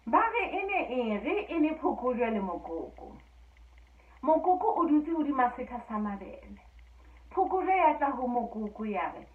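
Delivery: telling a folk tale aloud, lively and even; a storyteller sharing a fairy tale with listeners; story narration. A narrator's reading. Storyteller telling Basotho fairy tale to listeners, lively narration.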